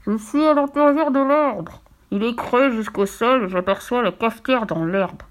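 put-on slow, drawn-out storybook child's voice; voice from inside the tree. A narrator's reading. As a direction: call up slowly from down below. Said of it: "la voix de Fifi qui venait". (voix lente qui appelle d'en bas)

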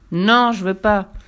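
loud stubborn no; boy refusing the witch; refusing child. Acted. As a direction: refuse outright.

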